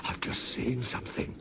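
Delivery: frightened whisper, barely voiced; shaky whisper. A narrator's reading. Toby whispers his fright to Thomas, shaky. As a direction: breathe it out, scared stiff. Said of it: "said Toby in a shaky voice".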